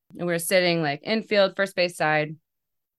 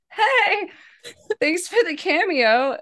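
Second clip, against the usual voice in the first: giddy thanks; 12 semitones higher, 4 dB louder, slower than usual. Retold or imitated. Thanking player from stands.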